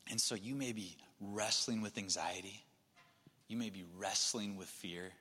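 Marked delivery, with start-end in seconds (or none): none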